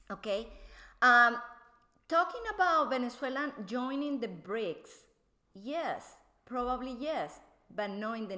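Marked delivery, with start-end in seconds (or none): none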